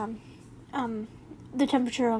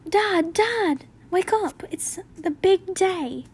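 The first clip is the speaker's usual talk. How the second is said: higher, brighter-pitched excited wake-up call, slowing at the end; eager kid voice; 6 semitones higher, 3 dB louder, slower than usual. Acted. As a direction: call out like a kid who can't wait. Child character waking the narrator.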